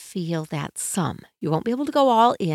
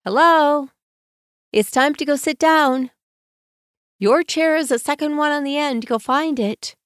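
put-on calm instruction to kids; 6 semitones higher than usual. Shown as a demonstration. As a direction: call the kids to sit, calmly and kindly. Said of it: "what do I want to say calmly".

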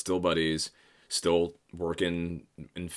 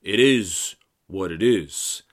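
slow, lightly voiced gangster line; a hint of a weary mob boss; movie line; 3 semitones higher, 7 dB louder, much slower than usual. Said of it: "a line that goes".

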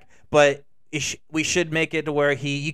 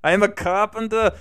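louder mock Russian accent; Russian-accented Jesus; 4 semitones higher, 5 dB louder than usual. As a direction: declare it in a fake Russian accent. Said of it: "why am I giving Jesus a Russian accent".

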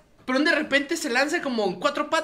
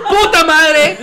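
louder laughing curse; loud outburst; 6 semitones higher, 9 dB louder, much slower than usual. Pretend. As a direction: blurt it out loud through laughter. Pretend reaction to burning salsa.

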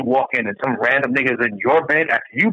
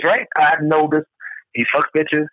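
normal talking voice

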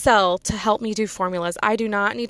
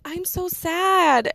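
mock sad; 8 semitones higher, much slower than usual. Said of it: "he was like".

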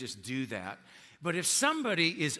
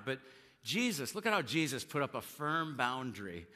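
ordinary delivery

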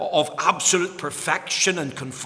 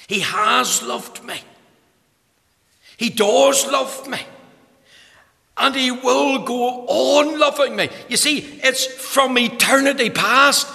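higher and louder, driving the phrases; full-force preaching; 10 semitones higher, 6 dB louder than usual. Acted. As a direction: proclaim eternity with fervour. Climactic preaching on love.

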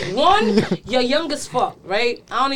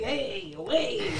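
soft hushed; 4 semitones lower, 11 dB quieter than usual. Retold or imitated. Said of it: "and I used to be like".

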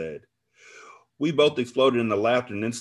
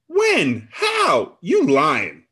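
high, loud furious outburst; angry roar; 9 semitones higher, 7 dB louder, much slower than usual. A narrator's reading. Friend confronts betrayer about the affair, roaring. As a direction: roar each short question in outrage. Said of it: "she roared".

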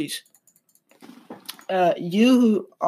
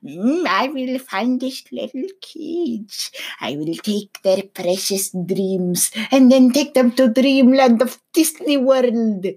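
higher, slow, sinister put-on villain croon; mock hacker voice; 6 semitones higher than usual. Vlogger mocking an imagined hacker, higher hammed-up villain voice. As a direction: ham up a creepy hacker stealing kids' voices. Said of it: "get out their voice and just".

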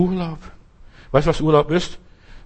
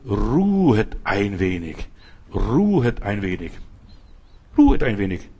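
calm Jesus; 6 semitones lower than usual. Acted. Jesus calming returning disciples.

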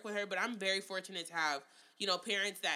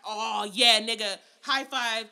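mock celebrating; 8 dB louder than usual. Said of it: "It was like".

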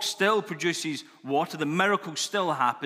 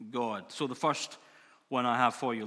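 ordinary talk

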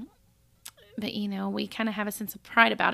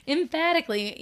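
emphatically said through a laugh, higher; laughing emphasis; 6 semitones higher, 4 dB louder than usual. Shown as a demonstration. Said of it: "E S V says emphatically".

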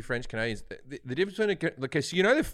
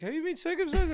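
1 mock doctor; 13 semitones higher than usual. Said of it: "A doctor once asked me".

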